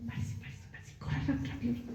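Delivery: hurried whisper, barely voiced; urgent whisper. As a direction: whisper it as if no one should hear. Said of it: "Yo hubiera podido, podido decir".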